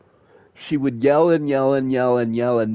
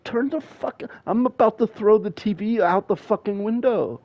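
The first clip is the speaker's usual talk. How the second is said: fed-up threat; 11 semitones higher than usual. Retold or imitated. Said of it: "And I was like".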